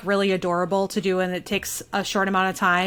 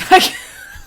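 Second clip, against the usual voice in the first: laughing; 6 dB louder than usual. Real, not acted. Laughs after singing.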